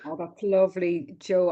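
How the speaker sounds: normal talking voice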